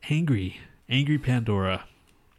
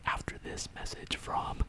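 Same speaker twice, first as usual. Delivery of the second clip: put-on whispered Mexican-accented announcer; creepy Mexican whisper; 3 dB quieter than usual. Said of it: "creepy Mexican whisper boy".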